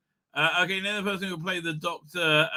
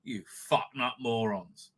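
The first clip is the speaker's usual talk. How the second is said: low, slow, softer insult; slow quiet contemptuous insult; 5 semitones lower, 8 dB quieter, slower than usual. Real, not acted. Repeating the insult to the producers, slower and softer. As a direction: drag it out with quiet scorn.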